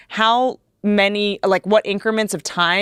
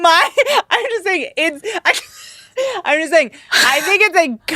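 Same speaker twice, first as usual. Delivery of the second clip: laughing; 8 semitones higher than usual. Real, not acted. Laughing while explaining.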